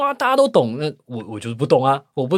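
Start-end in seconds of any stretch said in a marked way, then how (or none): 0.0-0.8 mocking dismissive. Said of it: "说".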